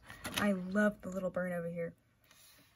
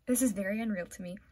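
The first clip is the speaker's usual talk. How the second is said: usual speaking voice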